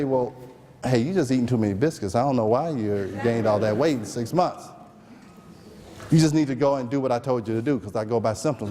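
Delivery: low, quick, brushing-off doctor voice; dismissive doctor. Retold or imitated. Doctor brushing off a patient's weight gain, low and quick. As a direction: wave her off like a smug doc.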